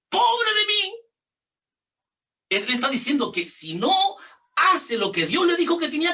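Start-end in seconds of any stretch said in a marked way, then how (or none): 0.1-0.8 lamento agudo y fuerte; lamento de Pablo. Retold or imitated. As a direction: clama con pesar como Pablo. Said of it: "El apóstol Pablo está diciendo lo mismo". (Pablo lamentándose)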